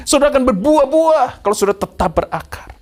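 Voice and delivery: high, loud declaration; rousing preaching. Acted. Preacher assuring congregation they will bear fruit, high loud proclamation. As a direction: proclaim the promise to the whole hall.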